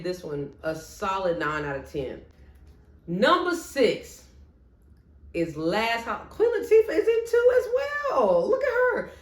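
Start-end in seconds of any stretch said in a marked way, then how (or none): 6.3-9.1 high, loud burst of delight; thrilled, pitched way up; excited. Real, not acted. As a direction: squeal over a favourite actress. Suddenly spots a favourite actress.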